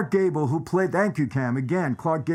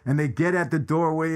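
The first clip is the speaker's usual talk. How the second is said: ordinary talk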